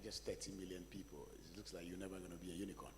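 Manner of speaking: ordinary talk, nothing marked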